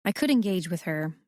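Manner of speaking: usual speaking voice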